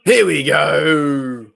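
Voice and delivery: slow, drawn-out loud shout of here we go; excited shout. Real, not acted. Fan shouting as his football game finally comes on, slow and loud. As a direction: let it roar out as the game starts.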